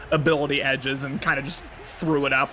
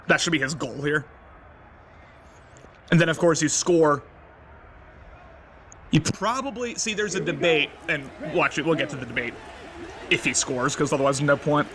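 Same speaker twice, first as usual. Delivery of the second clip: ordinary talk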